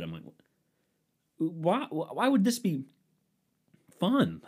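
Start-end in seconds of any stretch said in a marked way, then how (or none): 1.4-4.4 higher, drawn-out retold disbelief; baffled disbelief. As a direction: stretch it out, baffled, as if replaying the moment. Retelling own baffled reaction.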